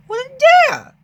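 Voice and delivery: very high, loud scornful cry; high, loud arrogant scoff; boastful shout. Acted. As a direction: shout it out, high and full of pride. Boastful engine dismisses warning.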